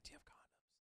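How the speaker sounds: hushed, breathless whisper; late-night dorm room, whispering to stay unheard. Retold or imitated. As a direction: whisper so the roommate won't wake. Late-night dorm, roommate asleep.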